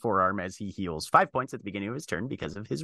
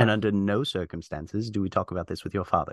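stern confidential warning; 6 semitones lower, faster than usual. Acted. Character forbids Scion telling father, stern and low. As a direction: warn in a lowered, commanding voice. Game master voices a character.

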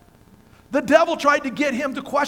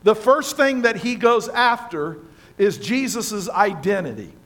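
usual speaking voice

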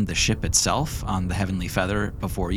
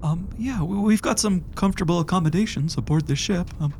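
put-on high old man voice, gentle; kindly old medic, much higher; 11 semitones higher than usual. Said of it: "the guy from the My Favorite Voice You Do".

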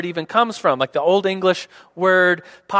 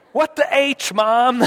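high-pitched mock exclamation; cheeky kid; 6 semitones higher, slower than usual. Kid trying a near-cuss on Mom, cheeky.

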